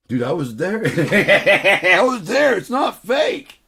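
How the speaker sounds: loud, high, drawn-out laughing protest; mock-indignant shouting. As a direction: insist at full volume through laughter. Mock-indignant defence of his story, laughing.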